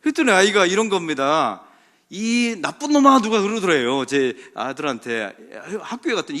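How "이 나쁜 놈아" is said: high, loud, drawn-out taunt; mock insult. Schoolmate insulting the preacher's son, mock angry taunt. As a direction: imitate a schoolkid calling names.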